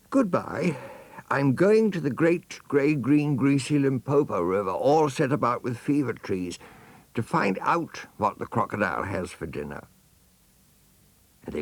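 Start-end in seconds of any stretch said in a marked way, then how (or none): 0.1-9.8 put-on high, eager young elephant voice; elephant's child. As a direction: sing out goodbye like an excited little child. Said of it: "and said to all his dear families".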